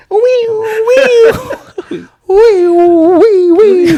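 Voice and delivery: very high, loud squeaky wee wee; a teasing high squeal she makes for no reason. Retold or imitated. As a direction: squeal wee like a giddy toy. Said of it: "That's the sound".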